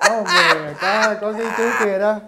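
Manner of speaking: loud laughing exclamation. Real, not acted. Laughing loudly while retelling the beer joke to the class. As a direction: laugh it out, cracking up.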